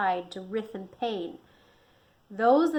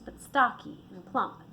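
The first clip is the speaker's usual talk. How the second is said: ordinary delivery, nothing marked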